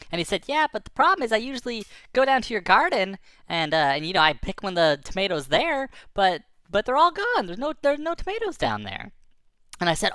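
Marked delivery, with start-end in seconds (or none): 0.5-9.1 high, earnest storytelling voice for a creature; explaining a problem in a raised pitch; lightly voiced little creature. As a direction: plead the problem, higher pitched.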